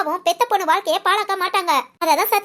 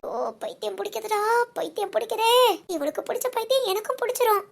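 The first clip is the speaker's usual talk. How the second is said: much softer exasperated muttering under the breath; grumbling to herself; 6 dB quieter than usual. Acted. Exasperated friend grumbles aside that she is going mad, muttered much softer. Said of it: "என்னடி புலம்புற?".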